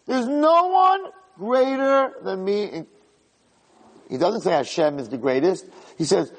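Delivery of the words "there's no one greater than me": high, slow and self-satisfied; proud servant lording it over the house; arrogant boast. Retold or imitated. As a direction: savor every word of the brag. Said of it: "So he makes a statement".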